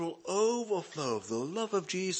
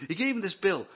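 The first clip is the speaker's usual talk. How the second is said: ordinary talk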